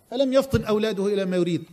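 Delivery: ordinary talk, nothing marked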